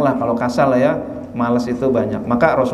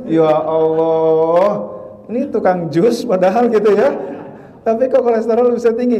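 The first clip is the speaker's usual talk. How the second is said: mock dismay; 6 semitones higher than usual. Preacher jokes about his cholesterol, mock dismay. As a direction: fake shock at his own cholesterol.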